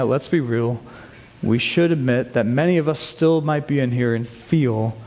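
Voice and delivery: normal talking voice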